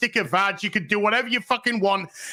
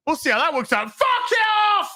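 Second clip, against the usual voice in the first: much higher, loud furious yell; angry shout; 12 semitones higher, 3 dB louder than usual. Real, not acted. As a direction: blow up at the fans. Angry outburst at fans.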